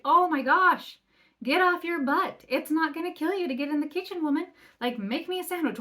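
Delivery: high, loud mocking impression of a rude husband. Wife mocking a rude husband who finds no dinner, loud and high. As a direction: ham up the lazy-wife scolding, pitched high and loud.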